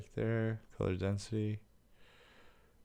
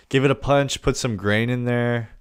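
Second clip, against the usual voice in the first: excited; 5 semitones higher, 10 dB louder than usual. Real, not acted. Excited editing exclamation.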